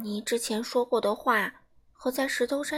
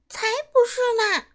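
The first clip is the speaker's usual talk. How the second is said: put-on much higher, loud childish shout; shouting together; 11 semitones higher, 7 dB louder than usual. Four baby dinosaurs shouting down the boastful one, loud and high. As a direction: shout him down all at once. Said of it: "另外四只甲龙宝宝大喊道".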